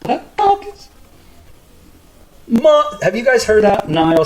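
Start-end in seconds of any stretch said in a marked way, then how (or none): 0.0-0.9 mocking high voice.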